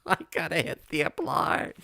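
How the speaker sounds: high, laughing panic; panicked. Real, not acted. Host realizes the application is still unsent, panicked. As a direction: squeak it out through flustered laughter. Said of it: "I'm panicking now".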